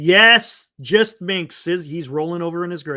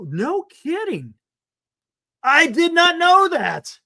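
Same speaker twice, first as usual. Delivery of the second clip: slow, much higher drawn-out amazement; surprised; 10 semitones higher, 6 dB louder, slower than usual. Real, not acted. Streamer hearing a surprising fact from chat, slow high amazement. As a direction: stretch it out like news that floors you.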